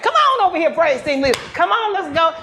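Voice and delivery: loud, high call across the room; loud excited call to a friend. Real, not acted. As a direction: summon her from the back. Calling praise team leader over.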